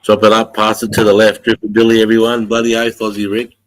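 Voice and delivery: loud, lightly voiced Aussie bloke; a hint of an outback larrikin. Plays an Aussie character.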